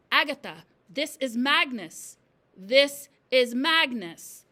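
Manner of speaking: slow, loud, looming pronouncement; ghostly message. A narrator's reading. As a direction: intone it slowly like a message from the dead. Ghostly chalk message read aloud.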